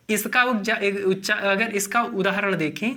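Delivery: ordinary talk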